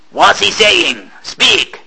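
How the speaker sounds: captain demanding a translation; impatient captain. Acted. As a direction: drag the question out, then snap.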